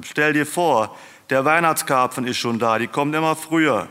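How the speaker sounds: high, loud, animated announcement; excited news. A narrator's reading. Husband tells wife about the early carp, excited. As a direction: share it as amazing news.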